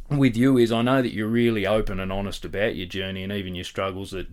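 ordinary talk

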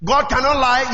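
ordinary talk

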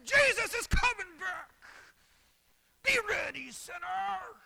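high, very loud hellfire shout; old-time preacher yelling. Retold or imitated. Preacher imitates old-timers warning sinners, yelling. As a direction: scream it like a revival preacher. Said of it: "old-time preachers yelling and screaming".

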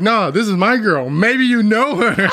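slow, high smooth line; smooth talker. Retold or imitated. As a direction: play it cool and slick.

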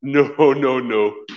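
emphatic mock refusal, lightly voiced; firm refusal. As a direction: shut it down flatly. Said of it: "Andrew Womack said".

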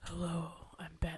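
hushed, whispered superhero growl; Batman voice. Acted. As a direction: whisper it like a low-key Batman. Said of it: "this is my Batman voice".

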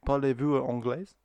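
loud, slow, high French question; French accent. Acted. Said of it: "we can do it at French accent".

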